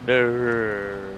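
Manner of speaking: low rumbling zombie groan. Pretend. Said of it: "What an impression of a Minecraft zombie".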